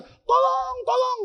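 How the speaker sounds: high, desperate call for help; mock cry of "tolong" into empty desert; hammed-up shout for help. Traveller crying for help.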